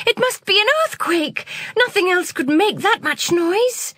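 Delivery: put-on high, panicky child's voice; scared out of her wits; terrified. A narrator's reading. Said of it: "in terror".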